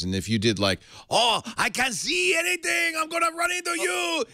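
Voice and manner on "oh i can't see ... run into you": put-on high, drawn-out shouted Chinese accent; mock Chinese accent. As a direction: wail it slowly in a hammed-up Chinese accent. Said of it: "like, you do a Chinese driver".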